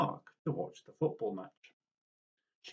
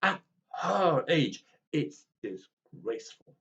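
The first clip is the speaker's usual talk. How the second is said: tutting disapproval; 3 semitones higher, 4 dB louder than usual. Acted. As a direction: sniff it out with disdain. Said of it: "tutted the other grannies".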